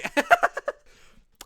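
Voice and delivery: loud burst of laughter; real, loud laughing fit. Real, not acted. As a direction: crack up loudly at your own silliness. Laughs at own shouting.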